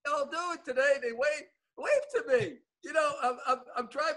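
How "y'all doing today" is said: a Southern drawl pitched higher, lightly put on. Shown as a demonstration. Trying the greeting again in a light Southern drawl. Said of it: "Southern drawl".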